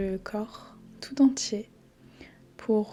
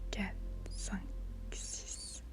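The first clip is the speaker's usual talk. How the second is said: very soft, whispery spaced counting; fading breath count; 11 dB quieter, slower than usual. Real, not acted. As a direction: let the count fade almost into breath. Compte de respiration qui s'efface. (compte chuchoté, presque effacé)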